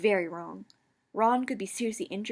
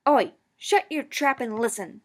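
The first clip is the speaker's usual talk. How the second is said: louder, slow warning growl; 5 semitones higher, 8 dB louder than usual. A narrator's reading. Girl growling at Ron to listen, louder. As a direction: shut him down hard. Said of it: "I growled".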